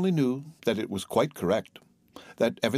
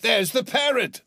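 very high, loud exclamation; excited cry; 13 semitones higher, 5 dB louder than usual. A narrator's reading. Scrooge spotting the parrot from his book, crying out. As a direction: cry out in sudden delight. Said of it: "cried Scrooge".

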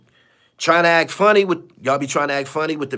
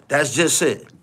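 ordinary talk

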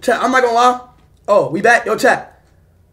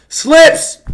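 loud raised call for help; 6 dB louder than usual. Real, not acted. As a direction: call someone from another room. Calling out for Slips.

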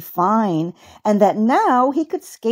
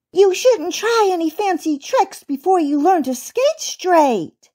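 put-on high, fussy child's reprimand; scolding; 9 semitones higher than usual. A narrator's reading. Rabbit girl correcting uncle's skating, high scolding. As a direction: lecture him like a little rabbit girl. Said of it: "scolded Bunty".

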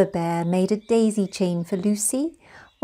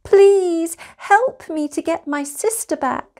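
pleading; 8 semitones higher, 4 dB louder than usual. Acted. Lucy begs the seller.

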